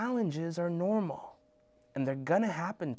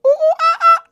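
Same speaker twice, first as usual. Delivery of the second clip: very high, loud monkey shrieks; monkey hoots; 17 dB louder than usual. Pretend. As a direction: hoot like a wild spider monkey. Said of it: "that was too much".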